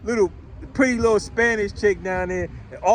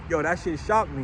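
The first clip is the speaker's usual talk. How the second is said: ordinary talk, nothing marked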